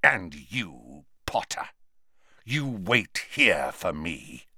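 low, measured, icy menace; quiet, controlled rage with deliberate pauses; cold fury. A narrator's reading. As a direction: drop the pitch and space the words out slowly. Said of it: "in a voice of cold fury".

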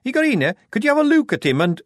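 mild nervous gabble. A narrator's reading. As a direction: hurry the words out anxiously. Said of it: "Shufti began nervously".

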